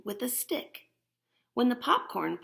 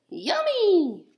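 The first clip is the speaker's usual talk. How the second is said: put-on much higher, louder delighted kid; boy voice; 11 semitones higher, 9 dB louder than usual. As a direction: squeal it like a happy boy. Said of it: "The boy says".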